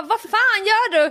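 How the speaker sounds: high, drawn-out mock-alarmed demand; startled outburst. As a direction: act out a frightened telling-off. Acts startled scolding of boyfriend.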